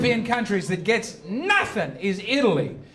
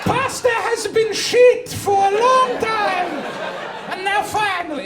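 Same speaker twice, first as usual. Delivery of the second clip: mock Italian; 9 semitones higher than usual. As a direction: play a boisterous Italian refusing the voyage. Italians praising new food, voiced.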